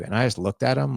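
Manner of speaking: ordinary delivery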